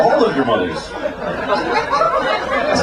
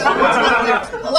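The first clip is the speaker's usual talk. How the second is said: high camp hello; 10 semitones higher than usual. Acted. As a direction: call it out as a hammed-up camp figure.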